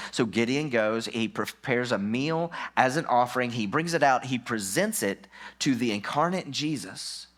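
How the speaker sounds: ordinary delivery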